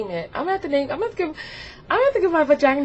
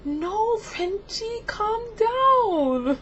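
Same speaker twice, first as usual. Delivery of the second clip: drawn-out high-pitched plea; high mock pleading; 11 semitones higher, much slower than usual. Telling Frenchie to calm down, pleading. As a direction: beg someone to behave, very high.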